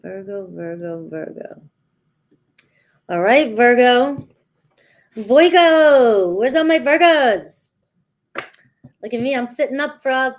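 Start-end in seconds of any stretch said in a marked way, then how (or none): 0.0-1.6 softly calling the name, fading to a whisper; hushed calling. Acted. Reader quietly calls for her Virgo viewers, whispering. 5.1-7.7 playful loud call, a bit higher. Acted. Reader loudly calls her Virgo viewers to show up.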